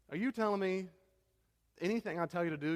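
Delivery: high-pitched, incredulous questioning; frustrated disbelief. Retold or imitated. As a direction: imitate a suitor at the end of his patience. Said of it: "he's getting frustrated and frustrated".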